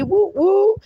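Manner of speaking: high, playful double whoop; celebratory whoop. Real, not acted. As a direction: cheer them on with a whoop. Cheers viewer who agrees.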